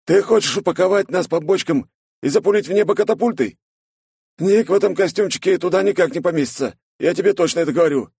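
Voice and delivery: high, fast mocking young guy; incredulous buddy voice. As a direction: scoff at a crazy plan. Snegir incredulous.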